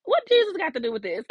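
put-on high, loud kid's protest; a young girl's disbelieving cry at her mother's advice; indignant little girl. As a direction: squeal it like an outraged child. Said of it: "I used to be like".

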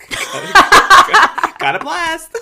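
cracking up, high and loud; laughing. Real, not acted. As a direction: burst out laughing through the words. Joking exit line, cracking up.

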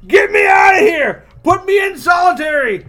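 high, very loud prisoner's plea; hammed-up desperate shout. Prisoner shaking the cell bars, begging out, hammed-up shout. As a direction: yell for rescue, cartoonishly frantic. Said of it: "he's, like, just shaking them. He goes".

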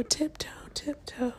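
soft playful whisper. Real, not acted. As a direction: whisper it while sneaking.

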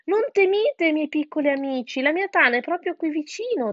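high, coaxing reassurance; crafty fox. A narrator's reading. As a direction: soothe them falsely to lure them in. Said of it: "disse la volpe furba". (volpe furba che rassicura con voce alta)

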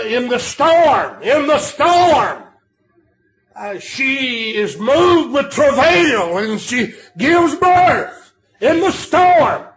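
slow, swooping cries on the key words; full-force preaching. Real, not acted. Preacher telling of the deer giving birth, full-force preaching.

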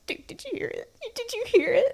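very high, squeaky excited aside; conspiratorial glee. Retold or imitated. Actress to co-star after crowd reacts, imitated gleeful squeak. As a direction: squeal it to your partner in crime, starting small. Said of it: "with a twinkle in the eye".

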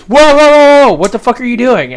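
retold alarmed yell. Supervisor catching a worker swearing, loud startled yell. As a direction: yell it like you just caught someone.

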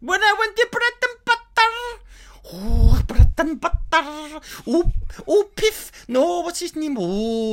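high, loud mock Scottish; replaying the Scottish slide. Friend recalls the gig, he redoes the accent. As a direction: relive the bit in a high Scots voice. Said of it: "It went Scottish".

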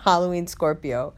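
ordinary talk, nothing marked

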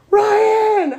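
high, loud ecstatic scream of Ryan; screamed name. Acted. Narrator screaming her lover's name at climax, loud high cry. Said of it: "I screamed".

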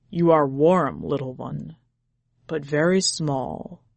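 low tender tiger voice; a fond big cat. A narrator's reading. Tiger speaks fondly from within the boy, low.

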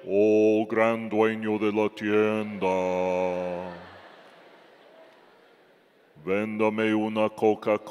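a hint of a Pharisee asking for a soda; mock pious prayer voice. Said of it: "nadie habla así".

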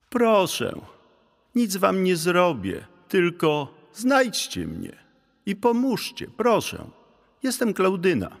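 very high, pleading little voice; Klaudyna. A narrator's reading. Ghost begging two animals for help, high pleading voice. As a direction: beg in a small, high ghost voice. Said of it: "odezwał się głosik".

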